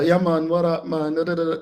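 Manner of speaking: relaxed Jamaican-style yeah man; casual island greeting, imitating staff; Jamaican hotel greeting. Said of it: "They're speaking in their natural".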